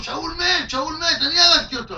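high, loud triumphant announcement; boastful news. Retold or imitated. Messenger boasting to King David that Saul is dead, high and triumphant. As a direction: proclaim it like good tidings.

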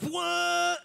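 high, surprised yelp; sudden high what; startled squeak. Retold or imitated. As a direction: squeak it like the car shot off. Car surges up hill.

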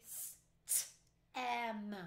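g-r-ate said sound by sound, slowly, each sound apart; chopped into sounds. Shown as a demonstration. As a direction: break g-r-ate into its separate sounds for the class. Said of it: "I'm going to chop it out loud".

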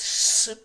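"Ш" as a loud voiceless rush of air; air through teeth. Shown as a demonstration. Teacher explaining how air makes the sound, hissed "Ш". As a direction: hiss a strong, isolated "Ш". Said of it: "через зуби проходить повітря".

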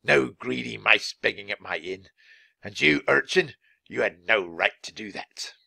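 put-on voice of a miserly innkeeper; cross innkeeper character voice; stingy innkeeper, scolding. Innkeeper scolding beggars and girl.

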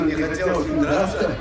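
ordinary talk, nothing marked

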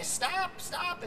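put-on high, croaky old woman's voice. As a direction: croak it like a cranky old lady in a nursing home. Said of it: "in this croaky voice".

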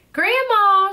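put-on girl calling out to grandma. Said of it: "she called".

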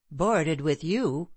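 ordinary delivery

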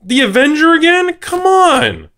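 very high, loud exasperated come on; annoyed at the same card again; frustrated. Real, not acted. Repeat card disappoints him.

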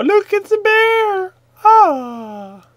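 high slow squealed bear; mock surprise. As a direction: squeal it in hammed-up shock.

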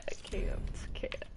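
very soft, unvoiced giving up; hushed, helpless muttering; defeated whisper. Real, not acted. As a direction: whisper it, utterly beaten. Giving up after losing the match.

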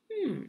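a rising, pondering hum; mock thoughtful hmm. Teacher modelling thinking for young viewers, a pretend pondering hum. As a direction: hum as if puzzling it out for the class.

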